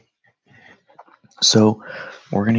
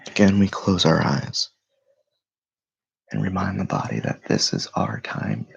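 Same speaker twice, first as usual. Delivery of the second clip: guided meditation; 5 dB louder than usual. Acted. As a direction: lead them through stillness, unhurried and steady. Leads a morning meditation.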